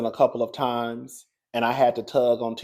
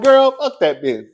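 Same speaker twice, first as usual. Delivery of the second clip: sassy dismissal; 8 semitones higher, 7 dB louder than usual. Retold or imitated. Fans dismissing Tia, voiced.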